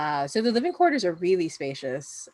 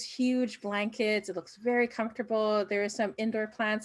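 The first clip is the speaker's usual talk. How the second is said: usual speaking voice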